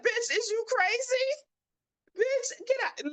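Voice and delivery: high, shrill-pitched outrage at the aide; outraged retort. Retold or imitated. Mom to the school aide, refusing to show a card, high outraged yell. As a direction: squeal it out in disbelief and fury.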